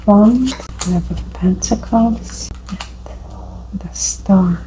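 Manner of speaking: slow murmur fading into a whisper; murmured to self. Real, not acted. Tarot reader naming drawn cards to herself, hushed murmur. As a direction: read the cards under your breath, slowly.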